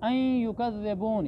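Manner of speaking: अन merged into the y of युकज़्ज़िबून as "ayy-yu"; idgham merging. Shown as a demonstration. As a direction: recite अन युकज़्ज़िबून with the n swallowed into a held y. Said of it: "नून का या में इदगाम हुआ है".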